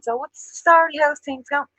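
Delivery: high, loud Dublin what's the story; breezy Dublin hello; Dublin greeting. Shown as a demonstration. Dubliner teaching a friend the slang, in a high Dublin accent.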